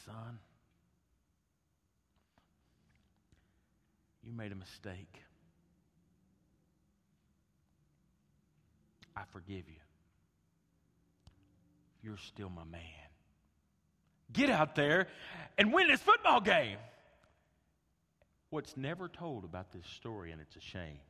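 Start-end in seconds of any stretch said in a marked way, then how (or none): 0.1-12.8 forgive him quietly, close up; tender coach. Retold or imitated. Coach forgives the crying player. 14.3-16.8 loud, higher rallying call; rousing coach. Retold or imitated. Coach sends player back out.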